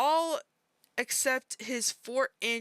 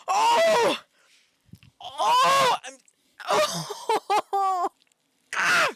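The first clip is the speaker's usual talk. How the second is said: loud, very high wailing "oh"s; horrified cries; 11 semitones higher, 9 dB louder, much slower than usual. Real, not acted. Horrified by what the post says, wailing outcries. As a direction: cry out in bursts, high and loud.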